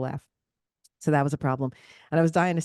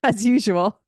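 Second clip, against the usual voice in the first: louder, higher, laughing; tossed off through a laugh; 5 semitones higher, 8 dB louder, slower than usual. Real, not acted. Laughing to her friend about her usual solo fun.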